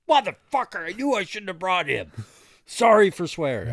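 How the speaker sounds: a father losing it as his son runs through mud; retold exasperated dad. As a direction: blow up, then apologize still shouting.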